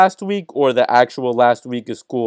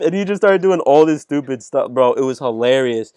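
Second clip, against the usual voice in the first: normal talking voice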